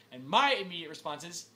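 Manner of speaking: ordinary talk, nothing marked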